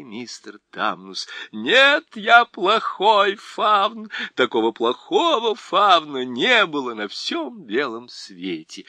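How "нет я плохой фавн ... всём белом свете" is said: sobbing despair. Acted. Faun weeping in despair.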